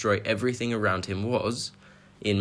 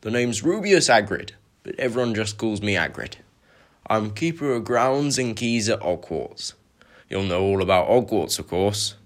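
Hagrid's voice; 4 dB louder than usual. Acted. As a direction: announce yourself like a big, proud groundskeeper. Hagrid introduces himself.

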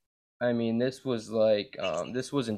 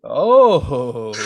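shocked shout; 9 dB louder than usual. Real, not acted. Reacting to the dog news.

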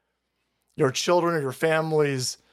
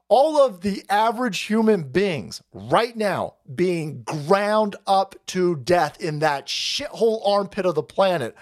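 ordinary talk, nothing marked

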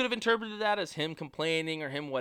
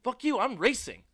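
mock yell; 5 semitones higher, 7 dB louder, faster than usual. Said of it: "he's like".

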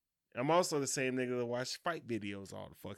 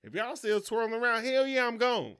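higher, louder angry outburst; heated confrontation; 7 semitones higher, 3 dB louder than usual. Retold or imitated. Husband telling wife to take an Uber home. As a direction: tell her off and send her home.

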